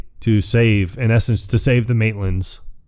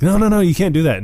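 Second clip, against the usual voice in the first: alarmed ghosts; 9 semitones higher, faster than usual. Retold or imitated. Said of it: "they're like".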